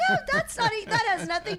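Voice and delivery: high laughing protest. Real, not acted.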